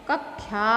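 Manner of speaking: कक्षा as low, soft "kakkhya"; regional pronunciation of कक्षा. Retold or imitated. Teacher repeats local error, कक्षा as "kakkhya". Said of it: "ये क को ख".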